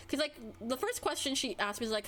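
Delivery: normal talking voice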